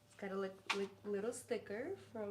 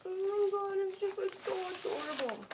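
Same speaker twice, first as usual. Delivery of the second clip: gushing squeal; 9 semitones higher, 5 dB louder, faster than usual. Real, not acted. Gushing over cute dog gift.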